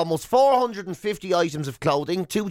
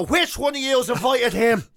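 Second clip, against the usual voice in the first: higher, indignant mock demand; mock outrage; 7 semitones higher than usual. Host spots Hitler at dinner, mock indignant. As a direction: act scandalised at the guests. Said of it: "I go".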